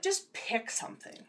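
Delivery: unvoiced, pressed whisper. Shown as a demonstration. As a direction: whisper the advice urgently. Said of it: "You know what?".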